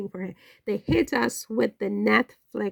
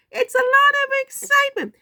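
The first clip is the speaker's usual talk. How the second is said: high, loud gushing over the drama; excited squeal; 5 dB louder, faster than usual. Real, not acted. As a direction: squeal it out with delight.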